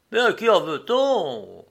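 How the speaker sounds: high, loud indignant question; exclaimed challenge. A narrator's reading. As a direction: challenge the murmuring crowd. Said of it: "s'écria l'intrépide passager".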